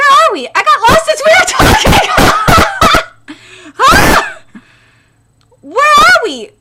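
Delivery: high, loud, laughing alarm; comic panic. Acted. Character realizing they're lost, laughing panic. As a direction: freak out with a laugh.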